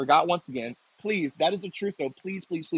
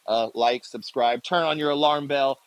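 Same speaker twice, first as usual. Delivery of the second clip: usual speaking voice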